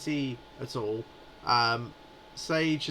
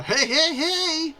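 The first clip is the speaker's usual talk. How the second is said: high, loud, showy triple "hey"; Ryan Drummond; 13 semitones higher, 8 dB louder, much slower than usual. Shown as a demonstration. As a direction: belt it out as an over-the-top voice-actor catchphrase. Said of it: "we're not asking Ryan Drummond to do a".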